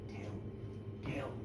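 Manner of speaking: eerie, barely audible whisper; whispered urging. A narrator's reading. Village women pressing Janet to speak, whispered. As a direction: whisper it like a menacing crowd.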